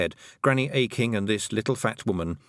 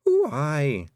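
smiling; 7 semitones higher, 4 dB louder than usual. A narrator's reading.

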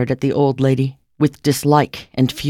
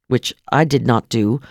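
ordinary delivery

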